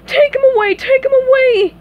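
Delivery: very high, loud frantic plea; panicked shout. Acted. Patrick begs the officers to remove it, panicked. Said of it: "he shouted".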